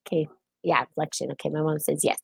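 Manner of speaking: ordinary delivery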